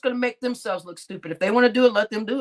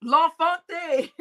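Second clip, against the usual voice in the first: laughing; 4 semitones higher, slower than usual. Real, not acted. Reading a viewer's name.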